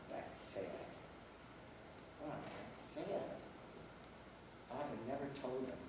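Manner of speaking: hushed and soft, almost breathed; quiet sorrow. Retold or imitated. Thinking to himself about the boy's father, softly sad. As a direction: let the sadness sink in quietly.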